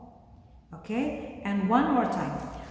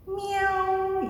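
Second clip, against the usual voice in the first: high mewing cat noise; cat meow; 10 semitones higher than usual. Shown as a demonstration. Teacher shows kids how a cat sounds, high meow. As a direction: mew like a kitten for the class.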